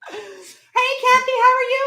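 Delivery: a high squeaky trial greeting; weird greeting. Shown as a demonstration. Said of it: "Hey, that sounded weird".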